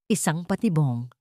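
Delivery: ordinary delivery, nothing marked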